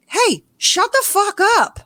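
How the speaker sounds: high-pitched, drawn-out mock outburst; slow, exasperated snap, pitched high; fed-up retort. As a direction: imitate someone who has finally had enough. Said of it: "so finally he has enough".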